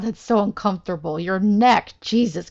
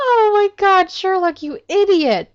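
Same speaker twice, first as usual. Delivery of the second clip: high, loud cry of exasperation; exasperated yell; 11 semitones higher, 6 dB louder than usual. Real, not acted. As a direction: yell at him in disbelief. Exasperated at Sherlock's blunder.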